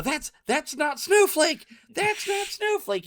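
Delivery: high, slow, loud Jim Carrey whine; Ace Ventura impression. Quoting the movie to friends, high Ace Ventura squeal. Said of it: "Then I also go".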